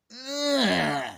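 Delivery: high, loud grossed-out groan; disgusted ugh. Retold or imitated. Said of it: "even Tarantino would go".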